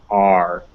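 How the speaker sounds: R said as "ar", vowel and r fused; low "ar" for R, vowel colored by the r. Shown as a demonstration. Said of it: "making it a unique sound".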